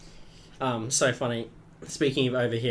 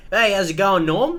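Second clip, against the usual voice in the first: put-on louder, higher old pub mate calling hello; a hint of an old man greeting his mate; old bloke greeting; 7 semitones higher, 7 dB louder than usual. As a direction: call out like an old regular at the pub. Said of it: "listening to them walk in".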